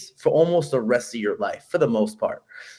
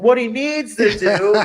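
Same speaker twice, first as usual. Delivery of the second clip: high, slow fight-commentator shout; Daniel Cormier; 9 semitones higher, 4 dB louder, slower than usual. Retold or imitated. Commentating his friend's fight, calling out in a high, slow commentator voice. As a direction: yell advice cageside like a commentator. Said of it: "screaming stuff out like Daniel Cormier".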